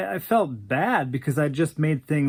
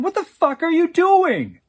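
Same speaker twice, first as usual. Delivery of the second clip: high, loud, outraged yell; shouted; 13 semitones higher, 7 dB louder than usual. Acted. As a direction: shout at him to stop. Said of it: "I shouted".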